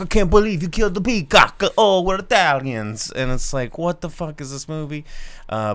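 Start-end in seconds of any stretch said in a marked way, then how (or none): 0.0-3.1 high, loud, scandalized cartoon Italian; hammed-up Italian family exclaiming; mock Italian accent. Host parodying the family in the trailer, mock Italian accent. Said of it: "And they're like".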